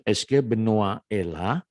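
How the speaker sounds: slow, careful French question with a rising end; slow reading. Shown as a demonstration. Teacher reads the dialogue slowly for students to repeat. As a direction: read "est-ce que Benoît est là" word by word. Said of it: "kalian dengarkan baik-baik".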